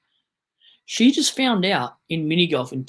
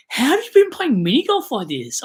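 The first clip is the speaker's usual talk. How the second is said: high, loud disbelieving question; incredulous quote; 8 semitones higher, 7 dB louder than usual. Retold or imitated. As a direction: act shocked at her mini golf rules. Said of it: "I was like".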